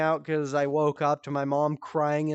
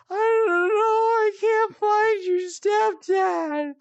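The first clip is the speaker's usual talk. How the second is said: high, slow, tearful mom voice; much higher mock-tearful plea; imitating crying mom; 4 dB louder than usual. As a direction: whimper it as the sleepwalking mom. Voicing crying sleepwalking mom.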